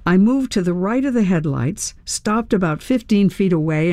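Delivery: ordinary delivery, nothing marked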